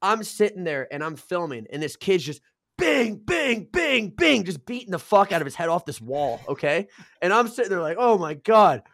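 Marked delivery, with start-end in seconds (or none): 2.8-4.4 high, ringing "bing" hits; rhythmic cartoon-like banging sounds; repeated impact noises. Imitates head hitting the wall. 8.0-8.8 loud, raised-pitch disbelief; acted-out loud astonishment; shocked exclamation. His shocked reaction, acted out.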